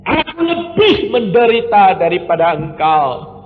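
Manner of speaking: high, loud preacher's cry of thanks; fiery preaching. Acted. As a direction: preach it out at the top of the voice. Preaching at full force. (khotbah berapi-api dengan suara tinggi dan keras)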